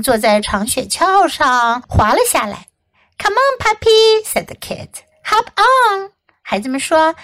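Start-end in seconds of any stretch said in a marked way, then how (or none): 3.2-4.2 calling to a puppy. A narrator's reading. Kids call puppy onto toboggan. 5.2-6.1 calling to a puppy. A narrator's reading. Kids call puppy onto toboggan.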